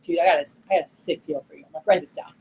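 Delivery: understated manager tipping off a rep; chill. Shown as a demonstration. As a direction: keep it cool and confidential. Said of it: "And I'm more chill".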